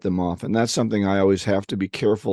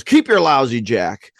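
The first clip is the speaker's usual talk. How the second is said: louder, slow, indignant outburst; mock angry yell; 3 semitones higher, 5 dB louder than usual. Worked-up driver to the stranger at the door, mock angry yell. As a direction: play an exasperated yell for the punchline. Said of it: "and he yells".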